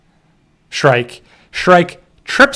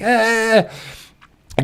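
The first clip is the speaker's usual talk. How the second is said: mock panicked whimpers, higher; panicking girl; 7 semitones higher, 6 dB quieter than usual. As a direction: imitate a frightened child's whimpering. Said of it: "'cause she's panicking".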